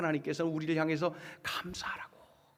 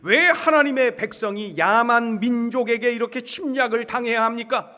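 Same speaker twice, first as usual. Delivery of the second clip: loud, high, impassioned plea; acted outcry of a prophet demanding answers; protesting prayer; 6 semitones higher, 10 dB louder than usual. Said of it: "하나님께 항변하면서".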